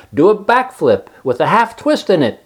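excited cry. Acted. Charlotte coaching Wilbur, excited cry. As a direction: egg him on. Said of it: "cried Charlotte".

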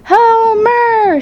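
high, drawn-out call across the farm; shouting for the men. A narrator's reading. Farm wife calls the men from the window, shouting high. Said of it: "immediately shouted for the men".

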